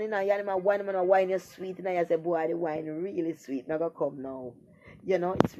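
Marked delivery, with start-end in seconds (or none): 2.2-4.5 low, soft imitation of someone enjoying it; mock pleasured murmur. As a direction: sink into a quiet, drawn-out purr. Voicing woman enjoying sex.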